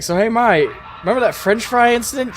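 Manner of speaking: sing-song tease to rub it in; playfully taunting a mother about her mistake; teasing. Pretend. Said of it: "It's like".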